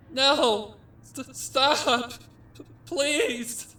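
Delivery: high, loud, stammering screams; filly screaming for mercy; hysterical cries. Acted. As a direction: beg through pure terror. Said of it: "came the hysterical cries from her sister".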